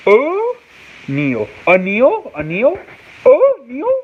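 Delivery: "O'Neal" pitched high, questioning; trying out "O'Neal" in a climbing voice; sounding out pronunciation. Shown as a demonstration. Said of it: "It's pronounced".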